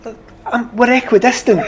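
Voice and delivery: mock protest. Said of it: "I said".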